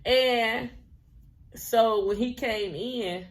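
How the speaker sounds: ordinary talk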